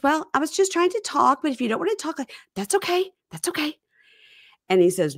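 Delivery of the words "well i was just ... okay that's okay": high, wounded mock wife; mock her hurt tone up high; high mocking wife impression; high mock voice of Darcy. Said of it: "She goes".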